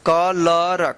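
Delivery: कलर drawn out in separate syllables; slow teaching demo of कलर; sounded out slowly. Shown as a demonstration. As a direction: stretch कलर into 'ka... lar'.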